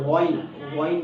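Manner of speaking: غین rounded at first, then opened, from the throat; throaty غین; throaty ghain. Shown as a demonstration. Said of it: "دیکھیں آپ مجھے".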